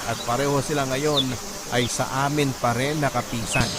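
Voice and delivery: normal talking voice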